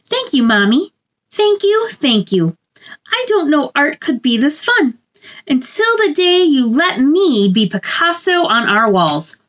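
gentle sing-song picture book reading; storybook read-aloud. A narrator's reading. Reading a picture book to children, sing-song storybook voice. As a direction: read it like bedtime story time for little ones.

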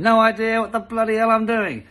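usual speaking voice

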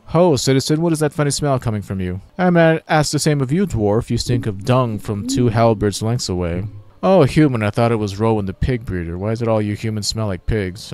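lightly voiced bantering townsfolk. Citizen and dwarf swap smell insults, lightly acted banter. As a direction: trade jibes in a light character voice.

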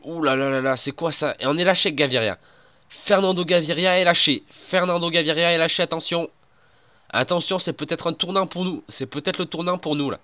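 high, loud race caller alarm; excited sports commentary. Acted. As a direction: shout the breakaway news live. Play-by-play commentary.

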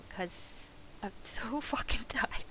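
unvoiced, slow whisper breaking into a laugh; tired laughing whisper. Real, not acted. Worn-out player admitting to chat she is tired, laughing whisper. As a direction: let the exhaustion laugh through a whisper.

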